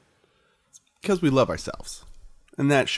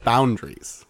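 usual speaking voice

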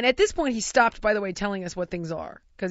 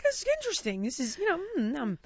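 mock polite; 8 semitones higher, 4 dB quieter, faster than usual. Feigning politeness about weird fish.

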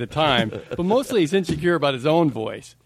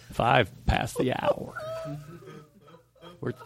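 lightly voiced radio host; 7 dB quieter than usual. [name] giving the time as a creepy host, lightly done. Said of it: "This guy's not, he's like a psychopath".

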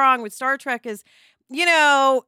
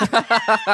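loud real laugh out of nowhere; burst of laughter; 4 dB louder than usual. Real, not acted. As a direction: crack up at the joke. Laughing at the joke.

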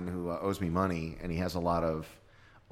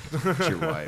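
high mock wife, louder; 10 semitones higher, 6 dB louder, slower than usual. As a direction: squeal it like an angry wife. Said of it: "I go, I was like".

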